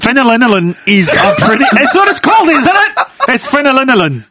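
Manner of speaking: high-pitched laughing talk. Real, not acted. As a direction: crack up mid-sentence. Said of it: "You know what that is off?".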